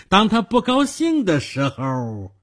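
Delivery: high, menacing build that hangs at the end; deliberately left unfinished. A narrator's reading. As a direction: dangle the threat and leave it open. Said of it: "我故意的不说完".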